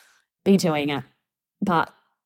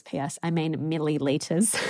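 laughing. Real, not acted. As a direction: chuckle at your own joke while you say it. Jokes about millions vs milliliters.

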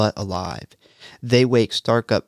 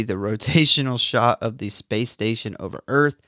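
normal talking voice

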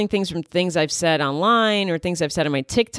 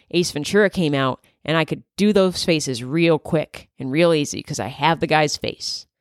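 ordinary talk, nothing marked

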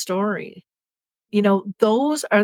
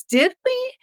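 much higher, innocent little "Did we?"; puzzled husband. Retold or imitated. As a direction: voice the confused husband who asks it sweetly.

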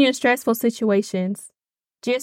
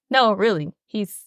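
soft, lower, growing quieter; gentle reassurance; 5 semitones lower, 3 dB quieter than usual. Retold or imitated. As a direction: soothe them softly, it's okay. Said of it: "but Jesus is like".

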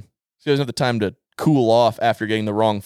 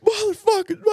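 strained, very high-pitched mock yell; imitated yelling. Son imitating dad cut off in traffic, yelling. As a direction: imitate a road-rage outburst way up high. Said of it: "and just yelling".

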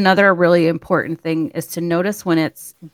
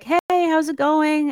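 high, drawn-out cheery greeting; super friendly; 8 semitones higher, slower than usual. Retold or imitated. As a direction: imitate an over-friendly shop worker. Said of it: "was super friendly and was like".

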